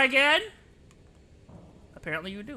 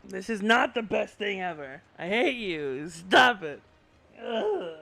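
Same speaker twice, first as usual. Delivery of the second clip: ordinary talk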